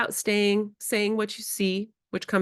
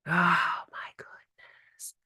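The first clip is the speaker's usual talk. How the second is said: slow hushed whisper; calm whisper; slower than usual. Shown as a demonstration. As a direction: breathe it out calmly. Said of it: "So I go calm".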